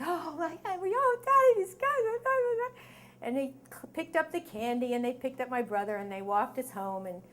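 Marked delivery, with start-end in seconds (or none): 0.0-2.8 very high, hammed-up kid panic; frantic babbling. Terrified kids telling Daddy about these guys, frantic mock babble.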